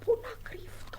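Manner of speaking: whispered worried question to himself. Acted. Child hiding, whispering to himself. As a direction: hush it, searching for a spot.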